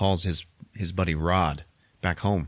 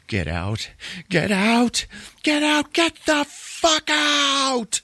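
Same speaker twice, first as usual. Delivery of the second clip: yelling, building; 3 dB louder, much slower than usual. Retold or imitated. Logan yelling at Chris after the flash, escalating scream. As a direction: escalate each repeat into a long, stretched mock scream. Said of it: "starts yelling at him".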